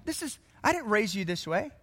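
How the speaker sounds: high-pitched, incredulous mock parent; disapproving parent. Parent confronting a newly converted child, shocked and high-pitched. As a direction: play a parent scandalized by their child.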